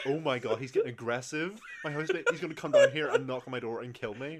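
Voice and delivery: loud mock-panicked outburst; retold panic. Retelling a fear of the aggressive housemate, mock panic. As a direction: act out mounting alarm at full volume. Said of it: "I'm, like, panicking".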